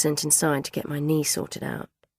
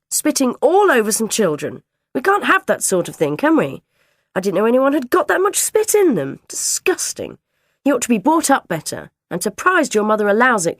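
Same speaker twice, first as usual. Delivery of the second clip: Spitting said high and loud with disgust on all; dinner lady scolding, high and loud; 7 semitones higher, 6 dB louder than usual. Acted. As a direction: rant in outrage at the spitting.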